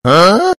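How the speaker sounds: sudden loud burst of laughter. Real, not acted.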